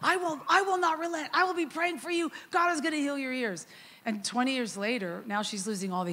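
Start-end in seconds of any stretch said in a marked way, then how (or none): 0.0-3.7 much higher, louder fervent insistence; insisting to her doubtful sister, fervent and high; insistent. Retold or imitated. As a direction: refuse to back down, pitch it way up.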